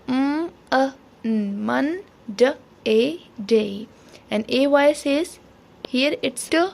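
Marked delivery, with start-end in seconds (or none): 0.1-3.9 म, अ as a short "uh", then न, मन, ड, ए, डे, each apart; sounding out Monday. Shown as a demonstration. Teacher shows children the sounds in Monday, sounded out piece by piece. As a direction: break it up as म, अ, न, मन, ड, ए, डे. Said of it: "here its sound is not a".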